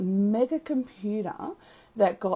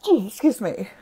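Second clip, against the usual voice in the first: after a cough; 7 dB louder than usual. Real, not acted.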